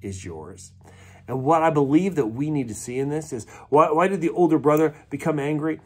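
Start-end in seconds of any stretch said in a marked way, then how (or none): 0.0-0.7 softer, gentle fatherly reassurance; tender father. Retold or imitated. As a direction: ease the son's anger kindly. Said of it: "the father says".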